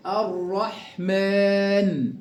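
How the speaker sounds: الرحمن very slowly, rolled double r and long aa; slow recitation. Shown as a demonstration. Teacher drills the sun lam, slow recitation. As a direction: roll the ر in الرحمن, stretch the ending. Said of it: "يلا نقرأ التدريبات تاني".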